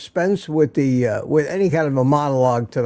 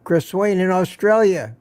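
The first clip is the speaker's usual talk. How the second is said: ordinary delivery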